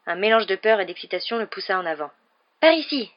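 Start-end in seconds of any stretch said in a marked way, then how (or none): 2.6-3.1 high, loud urgent call; crying out. A narrator's reading. Boy chasing the voice upstairs, urgent cry. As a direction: call the others to follow. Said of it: "s'écria-t-il".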